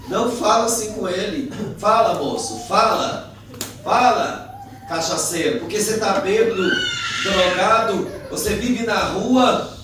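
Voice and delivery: high, loud goading; heated challenge. Retold or imitated. Friend insulting the drunk, loudly challenging. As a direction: taunt him and dare him to speak.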